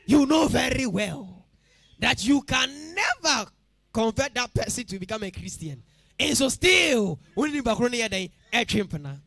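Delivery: put-on pastor warning church girls against marrying unbelievers, preaching loudly; preaching at full force. Pound out every warning to the church.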